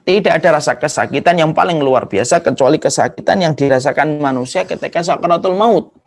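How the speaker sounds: normal talking voice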